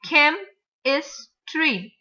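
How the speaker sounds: CHEM, IS, TREE as three separate beats; broken into syllables. Shown as a demonstration. Teacher counting three syllables, each said apart. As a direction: pause between "chem", "is" and "tree". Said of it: "three syllables".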